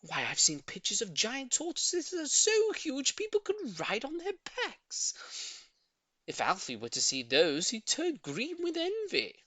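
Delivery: high, stretched wonder; dreamy old lady. A narrator's reading. Old lady dreaming of giant tortoises, high wonder. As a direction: paint the big picture.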